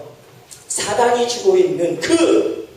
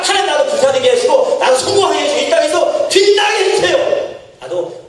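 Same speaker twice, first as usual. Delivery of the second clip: higher, pushy pleading that climbs; mock believer demanding success from God; greedy prayer; 6 semitones higher than usual. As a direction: beg God for glory, demanding. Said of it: "라고 하나님 앞에 가지고 나아가는".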